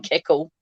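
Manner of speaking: "keckle" with a k in the middle; "keckle", k replacing the t's; mum's pronunciation. Retold or imitated. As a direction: quote mum's "keckle" for kettle. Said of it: "the fact that she says bath and keckle".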